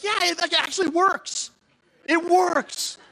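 high, loud, amazed insistence; thrilled. Retold or imitated. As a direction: crow it like a brand-new discovery. Thrilled pastor convincing the team.

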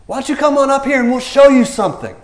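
high, loud taunting dare; mocking challenge. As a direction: call down a cocky dare from above. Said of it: "And then they said this".

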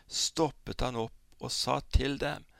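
ordinary delivery

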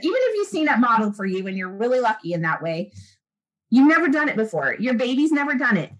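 ordinary talk

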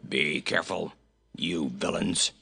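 low, gruff scolding voice; gruff, low warning; gruff goat. A narrator's reading. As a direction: grumble it low like a cross goat. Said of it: "a gruff voice cried".

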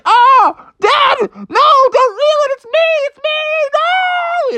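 very high, loud frantic cries; panicked Froggy. Acted. Froggy hooked on dad's line, very high panicked cries. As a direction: beg dad to stop reeling. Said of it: "He's like".

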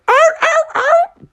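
very high squeaky dolphin yelps; dolphin cries. Acted. Bear leaps like a dolphin, very high squeaky yelps. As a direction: yelp it way up high, dolphin-style. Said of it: "And he went".

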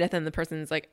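usual speaking voice

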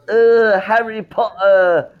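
very high, slow mock English accent; bad English accent. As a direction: mimic a terrible English accent on Harry Potter. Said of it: "he tries to go".

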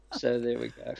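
ordinary delivery

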